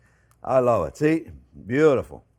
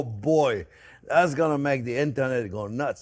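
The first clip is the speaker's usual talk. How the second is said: normal talking voice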